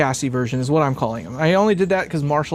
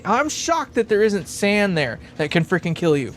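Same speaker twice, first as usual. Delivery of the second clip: usual speaking voice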